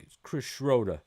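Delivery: imitated Boston accent, R dropped from Schroeder; a rough go at a Boston accent. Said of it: "but he's like".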